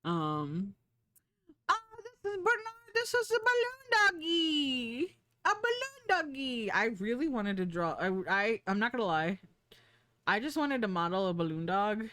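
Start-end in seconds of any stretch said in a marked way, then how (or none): 1.7-6.7 adoring squeal. Real, not acted. Streamer showing their balloon dog model, adoring squeal. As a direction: squeal it like greeting a puppy.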